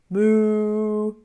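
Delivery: a drawn-out pretend cow moo. Teacher mooing for the children, a playful cow sound.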